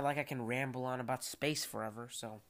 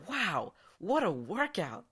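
mock impressed; 5 semitones higher, 3 dB louder than usual. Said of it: "and I'd go".

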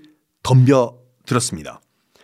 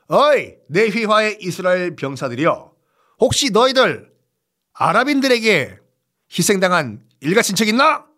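commanding officer address; 5 semitones higher than usual. Acted. Officer addresses his soldiers.

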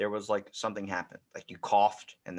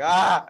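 higher, louder sudden yelp; mock dog yelp; 8 semitones higher, 12 dB louder than usual. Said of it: "a dog having a seizure".

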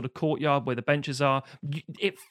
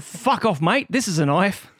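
loud, raised Australian tough talk; 3 semitones higher, 7 dB louder than usual. Acted. Australian Sandy warns someone off, mock Australian accent. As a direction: menace them with a hammed-up Australian twang. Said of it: "me doing the offensive accent".

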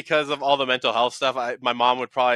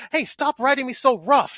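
put-on high-pitched anime girl voice; squeaky girl whine, pitched way up; high girly voice; 13 semitones higher than usual. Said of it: "this girl's just like".

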